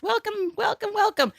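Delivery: high-pitched welcome; high sing-song welcome. Real, not acted. Host greeting sing-song.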